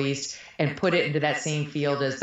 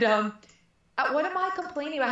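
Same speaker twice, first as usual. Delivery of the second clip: ordinary talk, nothing marked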